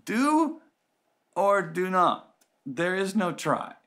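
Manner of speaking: high, drawn-out pronouncement of a wise master; Yoda. Acted. Jedi master correcting his hesitant student, high slow pronouncement. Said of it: "And Yoda says".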